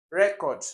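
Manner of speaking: Record punched loud and high on REC; stress on first syllable. Shown as a demonstration. Teacher listing first-syllable words, Record stressed on REC. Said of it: "have it on the first".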